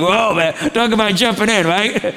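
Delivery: a father's delighted disbelief; excited reaction. Retold or imitated. Excited reply to his son.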